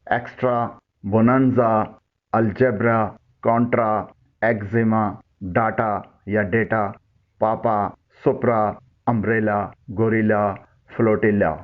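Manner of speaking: teacher modelling the final aa sound; careful pronunciation demo. Shown as a demonstration. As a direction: say ایکسٹرا, الجبرا, گوریلا ending in an open aa. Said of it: "ان الفاظ کی sound سنیں".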